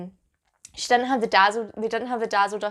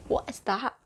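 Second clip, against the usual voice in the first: ordinary delivery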